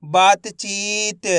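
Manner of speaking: बातचीत very slow, long baa and chee; blended word demo. Shown as a demonstration. Teacher answering the pupils' question, बातचीत drawn out. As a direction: stretch बातचीत by syllable. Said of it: "तो बताइए मिलके क्या बनेगा".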